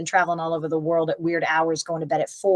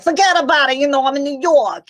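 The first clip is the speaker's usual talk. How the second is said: put-on brash New York accent, pitched up and loud; 9 semitones higher, 7 dB louder than usual. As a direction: throw out a quick New York tough-guy line. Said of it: "anything involving an accent for me".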